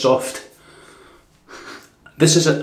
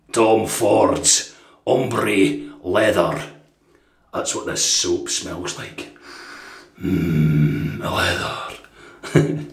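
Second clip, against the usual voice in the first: very slow, drawn-out Scottish voice, sinking lower and breathier; exaggerated Scottish accent; 3 semitones lower, much slower than usual. Shown as a demonstration. Vlogger describing the soap's scent, hammed-up Scottish accent. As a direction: savour every word in a thick Scottish accent. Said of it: "I'll say it in my Scottish".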